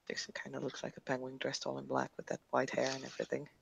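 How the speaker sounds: hushed unvoiced whisper. Real, not acted. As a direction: whisper it aside, barely voiced. Whispered aside about Dixon.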